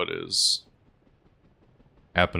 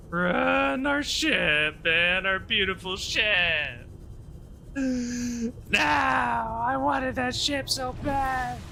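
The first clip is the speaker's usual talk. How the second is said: high, wailing panicked cries; terrified gibbering; slower than usual. Real, not acted. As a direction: scream and whimper in panic. Panicking, ship destroyed, dying.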